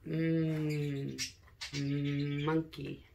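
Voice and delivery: usual speaking voice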